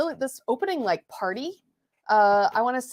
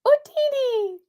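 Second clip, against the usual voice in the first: high squeaky Jawa exclamation, much higher pitch; Jawa cry; 4 dB louder, slower than usual. Acted. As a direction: chirp it like a tiny desert scavenger. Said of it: "we had to ask a Jawa".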